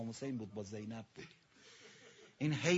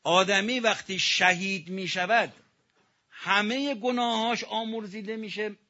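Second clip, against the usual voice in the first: preaching at full force; 8 semitones higher, 10 dB louder than usual. Acted. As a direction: hammer the point home from the pulpit. Sermon peak.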